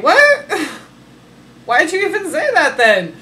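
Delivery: loud, high-pitched incredulous outburst; hammed-up indignant protest; mock outraged disbelief. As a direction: burst out high and loud, laughing. Acts out broken cake promise.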